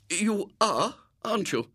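high-pitched, doubtful lady; uncertain repeat. A narrator's reading. Woman unsure it is William repeats her question, doubtfully. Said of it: "she repeated".

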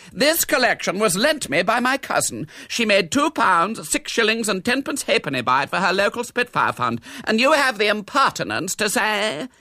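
high, loud protest; offended, high-pitched tirade; indignant defence. A narrator's reading. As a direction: defend yourself in outraged tones. Accused hostess defends her exhibition.

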